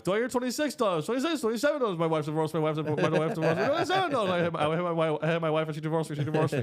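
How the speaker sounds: fast, high, rhythmic auction patter; hammed-up auctioneer calling bids. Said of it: "real auctioneers, what they're really saying is".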